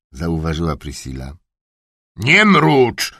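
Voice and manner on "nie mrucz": high, loud nie mrucz with weight on nie; barked order. A narrator's reading. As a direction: snap at her to stop mumbling. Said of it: "huknął książę".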